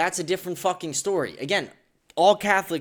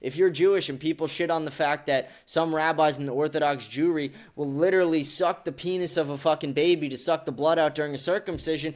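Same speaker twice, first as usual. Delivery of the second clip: ordinary talk, nothing marked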